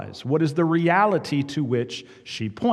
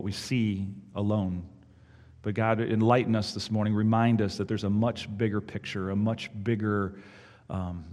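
hushed, lower prayer voice; opening prayer; 7 semitones lower, 4 dB quieter than usual. Acted. Pastor leading the congregation in prayer, hushed and low. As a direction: pray it gently to God. Pastor prays before the sermon.

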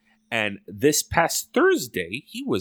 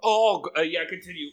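strained after spicy bile; throat burning, eyes watering; mouth burning from spicy food; 7 semitones higher than usual. Real, not acted. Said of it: "my eyes are watering".

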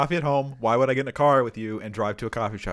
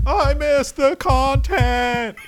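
whiny fan voice; 12 semitones higher, 6 dB louder, much slower than usual. Pretend. Mock fan complaint.

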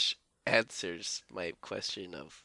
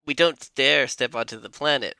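Nemoidian voice; 3 semitones higher, 9 dB louder than usual. Retold or imitated. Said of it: "the one who's like".

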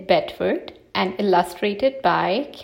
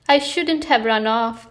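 ordinary delivery

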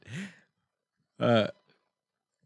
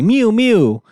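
higher, louder little mewing; high baby mew; 10 semitones higher, 5 dB louder than usual. Retold or imitated. Host imitating the cyborg baby's mew, high and loud.

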